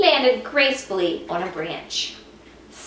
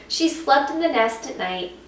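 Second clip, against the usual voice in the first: usual speaking voice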